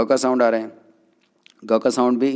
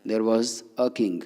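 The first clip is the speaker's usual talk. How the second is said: slow example; 3 dB quieter, much slower than usual. Shown as a demonstration. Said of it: "तो राजा में भी क्या लगेगा".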